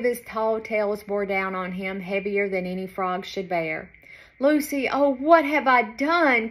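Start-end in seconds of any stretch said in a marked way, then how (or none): 4.4-6.5 distressed cry. A narrator's reading. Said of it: "croaked Frankie".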